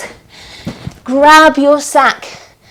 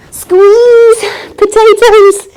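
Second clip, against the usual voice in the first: higher, playful squeezed voice; excited; 7 semitones higher, 3 dB louder, much slower than usual. Pretend. Teacher squeezing into a potato shape, playful strain. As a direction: squeeze the word out.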